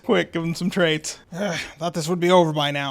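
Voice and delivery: ordinary talk, nothing marked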